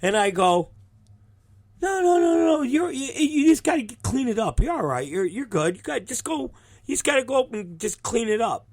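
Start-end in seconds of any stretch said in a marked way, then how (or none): 1.8-8.7 high-pitched, hurried placating, softening as it goes; mock reassurance. Telling injured wrestler to just clean it up, mock reassurance. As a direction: play it down fast in a high, unconvincing voice. Downplays wound to calm her.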